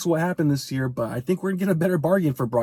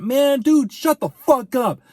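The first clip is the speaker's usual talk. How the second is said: exasperated outburst; 8 semitones higher, 3 dB louder, slower than usual. Real, not acted. Ranting at a pundit.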